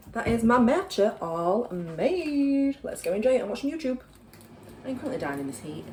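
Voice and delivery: ordinary delivery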